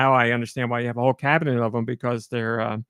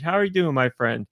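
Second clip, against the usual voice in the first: usual speaking voice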